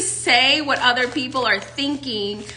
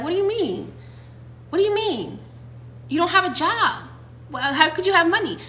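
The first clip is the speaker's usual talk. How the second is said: universe voice; 6 semitones higher than usual. Acted.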